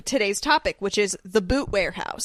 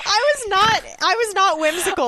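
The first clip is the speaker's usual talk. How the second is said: laughing; 9 semitones higher, 3 dB louder than usual. Real, not acted. Laughing admission.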